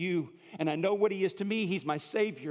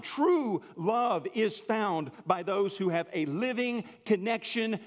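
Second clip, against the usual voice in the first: raised, driving sermon voice, higher; fervent preaching; 5 semitones higher, 3 dB louder than usual. Acted. Pastor building to the truth for the congregation, fervent. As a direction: preach it out with conviction.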